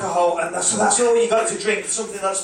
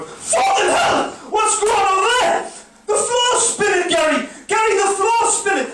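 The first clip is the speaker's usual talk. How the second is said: high, loud, alarmed yelling at the spinning floor; panicked shout; 13 semitones higher, 5 dB louder than usual. Retold or imitated. Drunk punter yells to his mate Gary in panic. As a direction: freak out, voice shooting way up.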